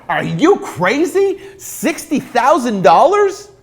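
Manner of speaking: high, slow incredulous outburst; a seller who can't believe the lowball offer; outraged seller. Retold or imitated.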